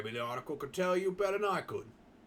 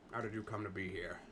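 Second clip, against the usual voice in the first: trial voice; 6 semitones lower, 8 dB quieter than usual. Shown as a demonstration. Testing a character voice on the question, lower and softer. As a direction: lower and softer, a muted take. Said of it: "I'll do my best to do an accent".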